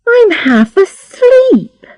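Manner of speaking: put-on high, drawn-out sleepy-girl whine; put-upon and high-pitched; complaining. A narrator's reading. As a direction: complain slowly in a high girlish voice. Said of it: "she complained".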